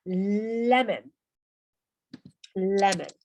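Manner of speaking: "Lemon" said loud, ending in a reduced "en"; pronunciation demo. Shown as a demonstration. Teacher showing learners the English pronunciation trick, "Lemon" with "-en". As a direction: model "Lemon" loudly with an "-en" ending. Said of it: "es un truquillo de la pronunciación".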